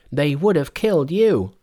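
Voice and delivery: high, loud monkey retort; high-pitched, protesting Monkey; indignant Monkey. A narrator's reading.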